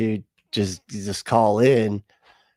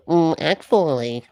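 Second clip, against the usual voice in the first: slow, high know-it-all voice; lightly voiced smug nerd; nerdy atheist; 5 semitones higher, slower than usual. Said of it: "accurate atheist impression".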